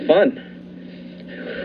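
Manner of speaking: ordinary talk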